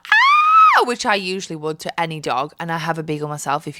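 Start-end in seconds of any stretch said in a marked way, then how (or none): 0.1-0.8 a sudden, very high, loud squeal of delight; excited squeal at a dog. Retold or imitated. Greeting a dog, a very high, loud squeal. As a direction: shriek with joy as if greeting a puppy. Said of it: "be like".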